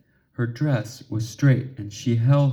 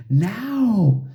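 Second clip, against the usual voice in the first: urgent push; 8 semitones higher than usual. A narrator's reading. Urging frozen Ginger.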